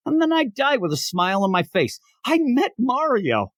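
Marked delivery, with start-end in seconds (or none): 2.2-3.5 high, slow, drawn-out last words; mock-dying fan, savoring it; blissful dying words. Host dies happy after meeting his idol, blissful. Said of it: "I'd say as I died there".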